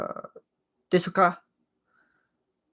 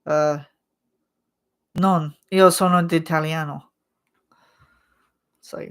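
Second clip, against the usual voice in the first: ordinary talk, nothing marked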